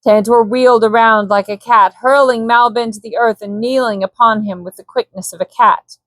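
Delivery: usual speaking voice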